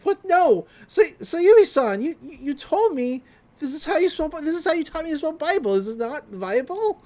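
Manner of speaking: high, loud flustered pleading; panicked protest. Retold or imitated. Ando begs Sayumi about spelling Bible, high panicked pleading.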